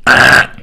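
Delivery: loud buzzer-like "ah" of rejection; imitated loud "ah" noise. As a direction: imitate the agent's abrupt honk of refusal. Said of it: "I'll never forget her going".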